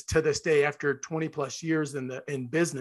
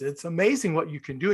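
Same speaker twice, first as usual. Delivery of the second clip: usual speaking voice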